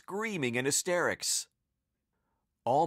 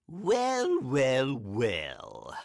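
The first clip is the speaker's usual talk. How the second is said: strange villain voice; much slower than usual. A narrator's reading. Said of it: "said a strange voice".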